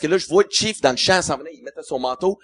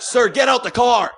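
slow, higher mock yell of an order; officer yelling; 7 semitones higher, 3 dB louder than usual. Border guard yells at him to get out. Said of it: "il me crie après".

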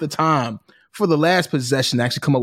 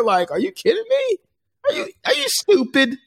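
high incredulous disbelief; shrill disbelief, voice jumping up; outraged. Real, not acted. Outraged rant about fake crowd.

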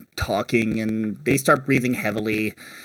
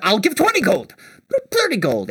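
higher, eager counter-offer; competitive outbidding; 8 semitones higher, faster than usual. Acted. Quarreling vendor bidding for help, higher-pitched outbidding. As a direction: top the rival's bid, pitch jumping up. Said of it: "The other goes".

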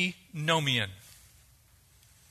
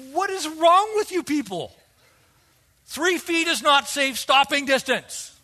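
annoyed driver; 7 semitones higher, 3 dB louder than usual. Retold or imitated. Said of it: "I'm getting a little angry. I'm like".

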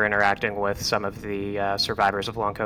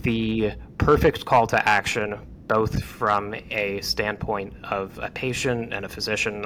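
ordinary talk, nothing marked

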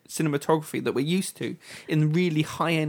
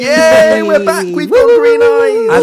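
high, loud, joyful cheering; excited cheer; 14 dB louder, slower than usual. Real, not acted. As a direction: cheer it out at the top of your voice. Cheering the show's return.

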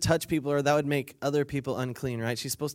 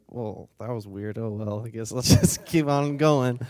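put-on low offhand voice; mock nonchalance; 4 semitones lower, 3 dB louder than usual. Imagined Jesus walking on, mock nonchalant. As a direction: play shrugging it off, with a laugh.

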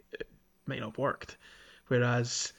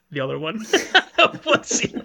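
laughing while groping for a word; giggling, high and loud, trying to remember; 4 semitones higher, 10 dB louder than usual. Real, not acted. Can't recall the tool's name.

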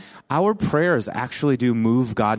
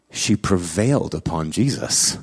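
normal talking voice

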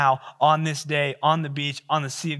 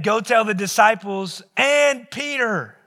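higher and louder, slowly leaning on "and Peter"; emphatic angel quote; 8 semitones higher, 6 dB louder, slower than usual. Acted. Preacher stressing that Peter is named, slow and loud. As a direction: hit "and Peter" hard.

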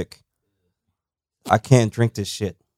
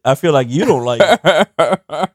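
laughing; 4 semitones higher, 4 dB louder, much slower than usual. Real, not acted. Teasing after friend's face.

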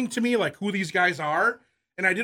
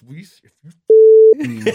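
slow, loud, breaking up with laughter; can barely get the words out for laughing; laughing quote; 4 semitones higher, 16 dB louder, much slower than usual. Retold or imitated. Retelling the coach's line, laughing hard. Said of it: "And he goes, he goes".

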